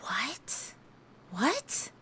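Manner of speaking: a stunned whispered "What? What?"; whispered shock. Retold or imitated. Clover finds her own psych file and whispers in shock. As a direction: breathe it out in shocked disbelief. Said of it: "she's like".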